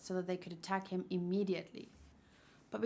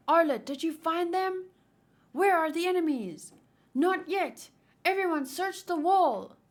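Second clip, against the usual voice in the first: louder and higher, urgent; urgent shouting; 8 semitones higher, 7 dB louder than usual. Acted. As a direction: shout orders across the wall.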